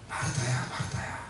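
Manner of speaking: very soft, low, hushed call; gently chide, almost under the breath; gentle reproof. Retold or imitated. Jesus gently reproving busy Martha, hushed and low. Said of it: "완곡하게 그 요구를 거절하면서".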